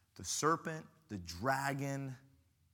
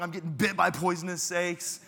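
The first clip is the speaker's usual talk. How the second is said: ordinary delivery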